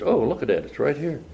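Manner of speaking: ordinary delivery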